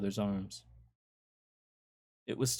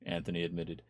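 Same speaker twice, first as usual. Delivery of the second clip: plain reading voice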